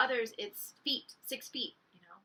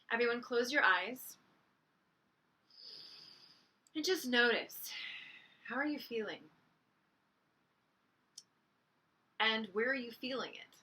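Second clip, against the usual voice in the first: guided meditation; 5 dB quieter than usual. Real, not acted. Teacher guiding listeners to breathe and picture light, guided meditation. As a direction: lead them inward gently, leaving long silences.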